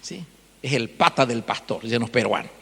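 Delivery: ordinary talk, nothing marked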